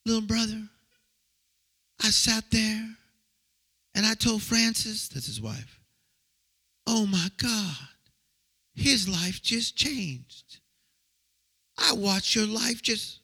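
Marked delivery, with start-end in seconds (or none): 0.0-5.1 [name] impression. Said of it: "That's how he talks". 6.9-13.2 [name] impression. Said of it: "That's how he talks".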